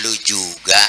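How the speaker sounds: soft, low, even answer; reply coolly, no heat; calm reply. Shown as a demonstration. Prophet answers the curse softly and calmly. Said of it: "balikin aja".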